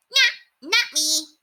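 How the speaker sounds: rat puffing up his chest; high rat voice, proud. A narrator's reading. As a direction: rat boasting he has no family. Rat character voice.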